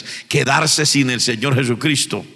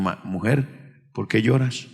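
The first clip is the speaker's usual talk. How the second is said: angels' gentle question; 5 semitones lower, 5 dB quieter, slower than usual. Acted. Angels ask weeping María.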